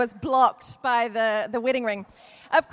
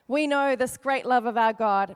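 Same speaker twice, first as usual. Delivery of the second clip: ordinary talk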